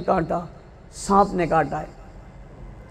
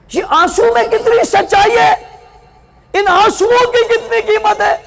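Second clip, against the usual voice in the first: impassioned preaching; 7 semitones higher than usual. Acted. Preacher crying out to mothers and sisters, impassioned preaching. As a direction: preach it out to the crowd, voice soaring.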